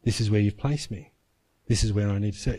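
low, subdued prayer to God; retold prayer, softly and low; recalled prayer. Chaplain reminding himself before God, low subdued prayer. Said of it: "it kept coming back".

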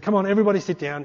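loud, high, quick instruction; imitated pastor calling out. Pastor winding up prayer time, calling out to congregation. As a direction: call it out briskly to a congregation. Pastor calls the room to order.

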